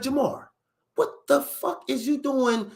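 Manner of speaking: high-pitched, drawn-out parental disbelief; imitated angry dad. Imitating dad who caught son skipping school, high and incredulous. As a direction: mimic a father catching his son out.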